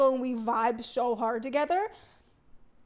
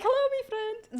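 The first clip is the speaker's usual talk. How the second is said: higher copy of Raj's greeting; 8 semitones higher than usual. Retold or imitated. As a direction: she parrots Raj back at him. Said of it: "I'm like".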